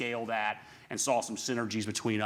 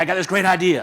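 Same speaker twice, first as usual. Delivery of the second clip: loud, high, excited pitch of an idea; eager pitch; 7 semitones higher, 12 dB louder than usual. Retold or imitated. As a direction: burst in with a big idea, much louder. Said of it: "I could come to my brother and say".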